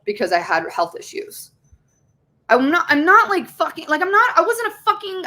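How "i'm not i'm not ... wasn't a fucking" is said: upset outburst. Real, not acted. Mother defending herself against her ex's accusations, heated and high. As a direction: voice jumps much higher and louder, frustrated. Venting about ex calling her unstable.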